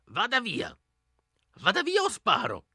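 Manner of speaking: put-on old woman shouting from behind the door; an old lady threatening to shoot; strangely deep voice. Old woman yells at strangers at her door, threatening. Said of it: "con una ciangotta stranamente profonda".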